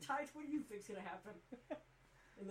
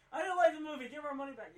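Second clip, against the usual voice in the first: louder, higher whiny demand; mock complaining; 4 semitones higher, 11 dB louder than usual. Moviegoer demanding money back from the cinema, mock complaining. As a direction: protest like a put-out customer. Acts customer demanding a refund.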